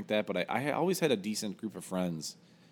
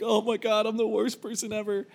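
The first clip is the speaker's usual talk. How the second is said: mock panic; 13 semitones higher than usual. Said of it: "I'm like".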